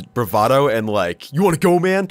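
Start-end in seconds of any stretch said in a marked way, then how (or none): 1.3-2.1 high, loud mock tough-guy taunt; hammed-up jock daring someone to fight; macho challenge. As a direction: bark it like a meathead picking a fight. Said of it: "type shit".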